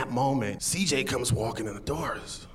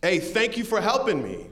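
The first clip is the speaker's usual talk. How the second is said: mock yell; 9 semitones higher than usual. Young man on curb thanks departing driver, imitated yell. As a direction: imitate someone calling out thanks from a distance. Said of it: "I heard him yell out".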